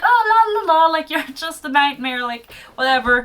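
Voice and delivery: high sing-song dismissive la la. Retold or imitated. Heroine mockingly dismissing the nightmare, high sing-song. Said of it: "she was like".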